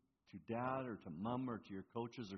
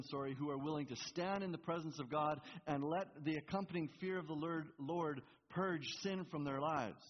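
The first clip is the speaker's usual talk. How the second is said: ordinary talk, nothing marked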